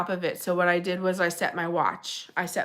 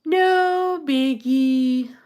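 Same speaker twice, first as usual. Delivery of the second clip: No biggie stretched out very slowly and higher, sing-song; drawn out; 6 semitones higher, 5 dB louder, much slower than usual. Real, not acted. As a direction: draw out No biggie long and high, playfully. Repeats phrase drawn out, higher.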